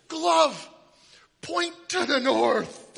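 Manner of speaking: high, loud, slow command; mock magician. Pastor redoing the lame trick for the congregation, hammed-up. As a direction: ham up the spell for the congregation.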